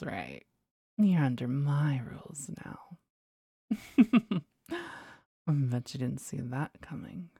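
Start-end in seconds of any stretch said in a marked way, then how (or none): 3.7-4.4 short playful seductive laugh; teasing giggle. Acted. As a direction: giggle like you caught him. Teasing laugh after rules.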